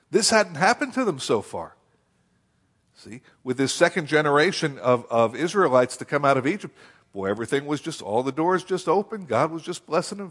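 ordinary talk, nothing marked

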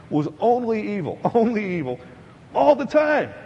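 "only evil" hit high, "all the time" loud and high; emphatic stress. Shown as a demonstration. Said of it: "Every thought man had".

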